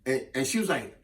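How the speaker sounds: ordinary talk, nothing marked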